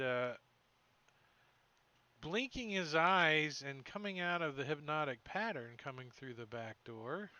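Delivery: ordinary talk, nothing marked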